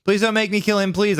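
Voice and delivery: high, urgent plea; begging. A narrator's reading. Ebony pleading not to kill him, begging. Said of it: "I begged".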